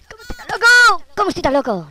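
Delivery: high loud crazed yell; manic shout. Real, not acted. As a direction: shout it like a madman.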